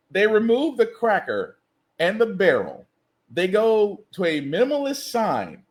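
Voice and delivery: ordinary delivery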